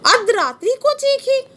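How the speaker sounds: high, ringing recital of the verse; poem recitation. Shown as a demonstration. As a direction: lift the line up like a cry. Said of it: "ये question देखो पूछ रहा है".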